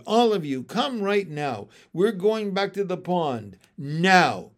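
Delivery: loud, urgent command with a hard final word; shouted order. A narrator's reading. Frog mother shouting her froglets back to the pond. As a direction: order the children home at once. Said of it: "I shouted".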